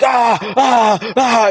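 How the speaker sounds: high-pitched mock drill-sergeant yells; a burst of hammed-up "ah" shouts; imitated yelling. Imitating his nervous early stage style for the host, mock yelling. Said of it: "you're just yelling at him".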